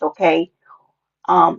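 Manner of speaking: ordinary delivery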